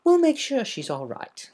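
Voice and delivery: calm a worried friend with a smile; smiling warmly. A narrator's reading. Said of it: "smiled warmly".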